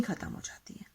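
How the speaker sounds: unvoiced whispered ending; teacher whispering the point. Shown as a demonstration. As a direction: whisper the payoff line.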